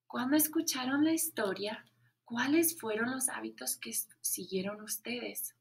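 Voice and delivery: ordinary talk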